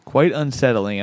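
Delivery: ordinary talk, nothing marked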